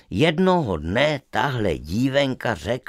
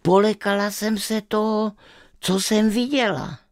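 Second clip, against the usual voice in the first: trembling; 8 semitones higher than usual. Acted. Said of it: "celá se třese".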